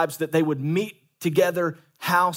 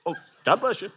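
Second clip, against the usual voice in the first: higher, sweet dismissive goodbye; polite brush-off; 4 semitones higher, faster than usual. Retold or imitated. Pastor playing member politely ending a call. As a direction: bless them out of the call. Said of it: "you're like".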